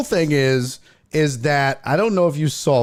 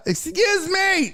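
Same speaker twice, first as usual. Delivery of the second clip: very high, slow, prissy mock voice; mock prissy woman; slower than usual. Mocking the woman who confronts the guy, high prissy squeak. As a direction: squeak it out like an offended lady.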